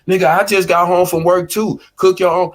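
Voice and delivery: match his energy, hit him back loud; mock angry snap back. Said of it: "matching my energy".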